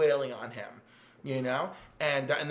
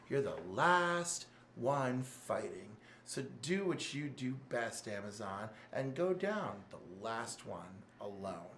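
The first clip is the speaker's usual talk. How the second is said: low, slow, soft villain voice, drawn out; menacing villain taunt; 4 semitones lower, 8 dB quieter than usual. Retold or imitated. Evil Batman taunting Diana, low slow menace. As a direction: lean in low and let the threat hang.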